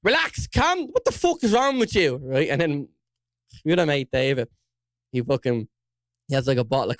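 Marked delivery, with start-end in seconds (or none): 0.0-2.2 alarmed plea. Retold or imitated. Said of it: "I'm like".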